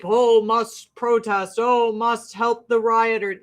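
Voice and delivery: slow, dopey mock chant of a protester; mocking sheep. Mocking the protest crowd as sheep, slow dull mock voice. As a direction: drone it like a brainless follower. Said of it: "All you sheep".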